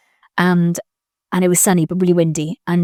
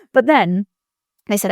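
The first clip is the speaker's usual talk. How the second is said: normal talking voice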